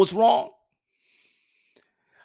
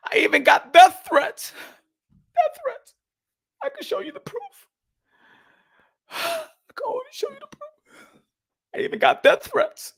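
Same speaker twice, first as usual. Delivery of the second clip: high, fake-sobbing complaint; mock crying; 14 semitones higher than usual. Telling viewers about death threats, mock sobbing.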